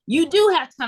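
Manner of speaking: mock scolding, high and emphatic; insistent mom. Voicing mom arguing back.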